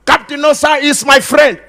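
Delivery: mock boast of a hanger-on. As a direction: brag like a hanger-on name-dropping. Mocking boasting about rich friends.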